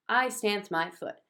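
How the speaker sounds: usual speaking voice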